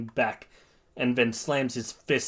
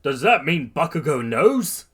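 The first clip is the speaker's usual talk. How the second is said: high, loud puzzled questions; startled realisation; 5 semitones higher, 7 dB louder than usual. A narrator's reading. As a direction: wonder aloud in astonishment. All Might's startled thought.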